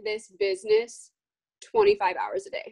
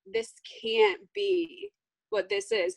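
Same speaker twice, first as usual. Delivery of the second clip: realizing; 10 semitones higher, slower than usual. Retold or imitated. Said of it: "I was like".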